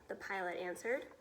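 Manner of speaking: usual speaking voice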